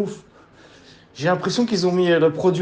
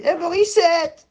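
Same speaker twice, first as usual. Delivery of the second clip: high, slow call of her name; mock nurse calling out, higher; calling out to the old lady; 10 semitones higher, slower than usual. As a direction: hail an old woman like a nurse would. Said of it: "ils lui ont dit à la vieille".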